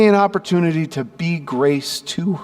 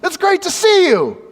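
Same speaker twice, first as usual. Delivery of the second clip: high, lively hello; enthusiastic greeting; 13 semitones higher than usual. Retold or imitated. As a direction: greet her like an old friend. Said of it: "I'm like".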